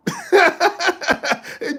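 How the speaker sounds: sharp high laugh after the joke. Real, not acted. Streamer about to remove a commenter, high laugh. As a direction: laugh at your own threat.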